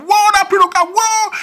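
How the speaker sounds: high, loud mock cheer from the neighbours; crowd cheering. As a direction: whoop like the street spotting a fight. Les voisins crient de joie. (cri moqueur des voisins, très aigu et fort)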